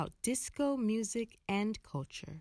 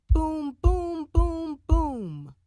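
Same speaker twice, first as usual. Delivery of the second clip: loud, high booming drum imitation; drum booms; 7 semitones higher, 11 dB louder, slower than usual. Shown as a demonstration. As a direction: boom it out again like a kick drum. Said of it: "Hear it again".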